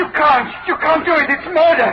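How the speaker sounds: high, loud, desperate protest; terrified pleading. Acted. Preston begs Blake not to throw him to the gang, terrified. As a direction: beg as if your life depends on it.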